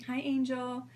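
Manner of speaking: ordinary talk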